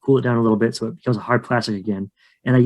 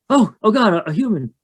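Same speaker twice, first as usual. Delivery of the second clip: much higher, fretful creature voice; nervous hairy swamp creature, pitched way up; skunk ape voice; 8 semitones higher than usual. Acted. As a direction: plead with a screaming human to calm down.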